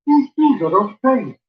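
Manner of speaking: slow, puzzled Welsh lilt; bewildered Welsh, drawn out; confused Welsh accent. Shown as a demonstration. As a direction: sound baffled in a Welsh accent. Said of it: "To do a good Welsh accent".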